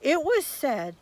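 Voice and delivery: a lively read-aloud fairy tale voice; storybook narration. A narrator's reading. Reading a fairy tale to children, storybook narration. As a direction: tell it like a bedtime tale.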